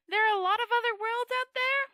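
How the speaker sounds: high, loud excited question; a wide-eyed little man's voice; Two Flower asking with wonder; eager tourist voice. A narrator's reading.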